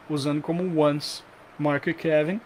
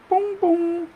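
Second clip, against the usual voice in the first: high-pitched "pum, pum" chime; imitated airport chime. Imitating the airport sound before a boarding call, chime-like. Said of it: "aquele som no aeroporto".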